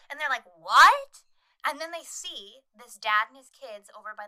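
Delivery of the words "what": loud, rising mock shout; startled question. Boaters answer the screaming skier, mock startled shout. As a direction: blurt it out high and loud, imitating.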